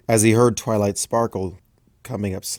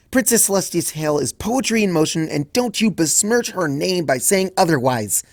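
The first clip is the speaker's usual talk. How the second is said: higher, fast, a touch of wailing; 8 semitones higher, 4 dB louder than usual. A narrator's reading. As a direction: protest with a comic wail. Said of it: "Twilight wailed".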